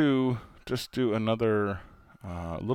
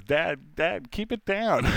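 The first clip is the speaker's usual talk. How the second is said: embarrassed son; 7 semitones higher, 4 dB louder, slower than usual. Retold or imitated. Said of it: "David just looks at me and goes".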